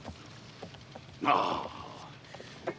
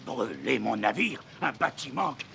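higher, louder disbelief; outraged; 10 semitones higher, 3 dB louder than usual. Acted. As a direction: build outrage at the idea. Captain outraged about his ship.

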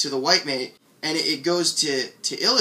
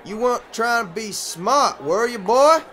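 high voice, louder on the last words; heckler's challenge; 8 semitones higher, 5 dB louder than usual. Acted. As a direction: press it as a warning. Heckler threatens the young speaker.

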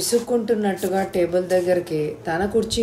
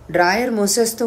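ordinary delivery

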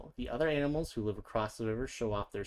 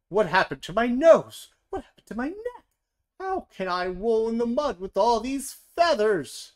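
wailing warthog; 11 semitones higher, 9 dB louder than usual. A narrator's reading. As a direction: squeal in dismay at the new body. Warthogs wail about changed bodies.

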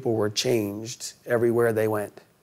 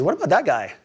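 curious Peter; 6 semitones higher than usual. Retold or imitated. Peter points at John.

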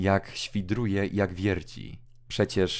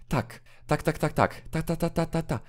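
high, light tapping "tak, tak, ta, ta"; imitated ticking knock; 9 semitones higher than usual. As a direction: tick like a clock under the pillow. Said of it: "Jak kołata?". (wysoko naśladowane kołatanie)

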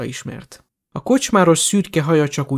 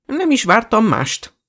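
put-on slow, higher-pitched proud old lady; 8 semitones higher, slower than usual. A narrator's reading. Grandmother hears her grandson is fighting, slow pride. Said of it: "nagy büszkén felszegte a fejét".

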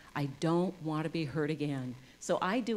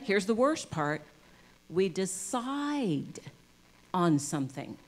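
ordinary talk, nothing marked

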